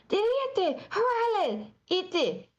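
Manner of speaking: mock chant pitched well up; high answering cheer. Worker retells answering the manager's chant in practice Ukrainian, high mock yell.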